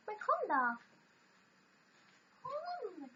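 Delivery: high coaxing pet voice. Real, not acted. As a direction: coax the cat sweetly. Coaxing the cat again.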